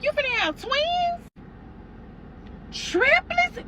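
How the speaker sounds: very high, thrilled squeal; excited guessing. Retold or imitated. Sister-in-law guesses twins, squealing excitedly.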